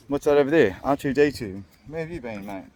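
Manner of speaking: normal talking voice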